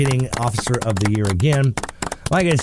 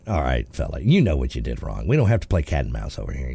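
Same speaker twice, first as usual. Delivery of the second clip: unimpressed officer; 11 semitones lower than usual. Retold or imitated. Officer to driver playing dumb, unimpressed. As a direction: drop low, as a cop who's heard it all. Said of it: "looking at you going".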